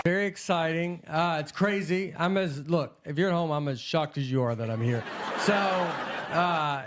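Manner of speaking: ordinary delivery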